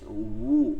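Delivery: "উ" drawn out with a w onset from rounded lips; rounded wu demo. Shown as a demonstration. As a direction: glide slowly into "উ" through rounded lips. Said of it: "বু থেকে বলতে হবে উ". (ঠোঁট গোল করে টেনে "উ")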